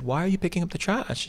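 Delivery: high, puzzled question to the trash picker. Retold or imitated.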